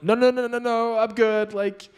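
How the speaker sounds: high, loud, fast no no no, then slow; refusing to let her cook the steak, panicked high pitch; panicked refusal. Retold or imitated. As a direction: push her offer away in a panic. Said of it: "I'm like".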